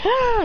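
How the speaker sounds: high loud attacking cry; sharp high war cry; battle yell. Retold or imitated. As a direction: shout it as they charge. Villagers rushing attack.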